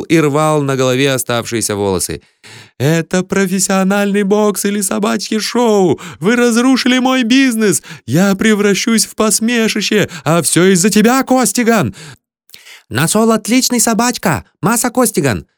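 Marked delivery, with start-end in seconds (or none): 2.8-11.9 high-pitched, frantic tirade; indignant outburst. A narrator's reading. Promoter rages, tearing his hair. 12.9-15.5 high-pitched, eager foreign seller; squealing. A narrator's reading. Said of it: "визжал китаец".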